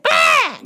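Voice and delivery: very high mock child screech; imitated tantrum scream. Child rejecting each color a parent offers, mock screech. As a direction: shriek a refusal like a fussy child.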